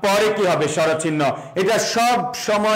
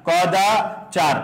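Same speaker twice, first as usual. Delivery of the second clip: কদাচার slowly, pausing before চার; slow syllable demo; much slower than usual. Shown as a demonstration. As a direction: split কদাচার into কদা, a gap, then চার. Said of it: "আমরা যদি বলি এইরকম".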